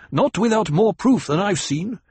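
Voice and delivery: angry accusation. A narrator's reading. Jameis accuses Jessica angrily.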